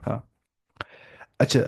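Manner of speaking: ordinary talk, nothing marked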